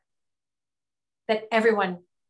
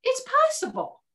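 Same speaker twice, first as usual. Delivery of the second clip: much higher, louder voice of the chief's son; imitating Francis; 4 dB louder than usual. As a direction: echo his joyful cry in a raised voice. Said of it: "he looks at me, he goes".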